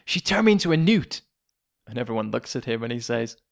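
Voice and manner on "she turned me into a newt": high, indignant villager's complaint; acted accusation. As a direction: accuse her in mock outrage. Acted accusation quoted from film.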